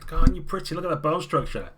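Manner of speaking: woman fawning over a stranger's looks; gushing admiration. Acted. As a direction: swoon over their face out loud. Woman touches player's face.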